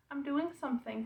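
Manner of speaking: high soft dog-talk. Real, not acted. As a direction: tell the dog sweetly you're busy. Telling the dog she's busy.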